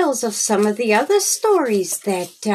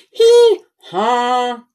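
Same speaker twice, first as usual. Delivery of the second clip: mock hee-haw, louder; donkey bray; 4 semitones higher, 5 dB louder than usual. Boy imitates donkey braying.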